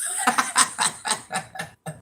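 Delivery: a short breathy laugh. Real, not acted. Laughing after quoting herself.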